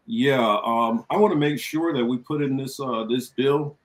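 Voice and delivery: normal talking voice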